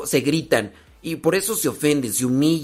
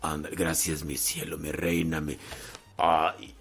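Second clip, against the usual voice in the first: muy suave y grave, meloso; endúlzale la voz como novio cursi; novio empalagoso; 12 semitones lower, 9 dB quieter than usual. Acted. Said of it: "antes cuando eran novios, que querían quedar bien". (novio empalagoso pidiendo la toalla con voz melosa)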